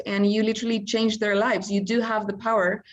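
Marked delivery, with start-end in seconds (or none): none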